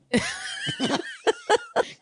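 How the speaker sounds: very high, long burst of laughter; shrieking laugh. Real, not acted. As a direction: lose it laughing. Laughing at the story.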